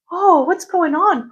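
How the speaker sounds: high, slow mock-shocked Oh; sing-song faux concern; pearl clutching mock. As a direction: play the fake-innocent gasp. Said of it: "Pearl clen-, pearl clenching".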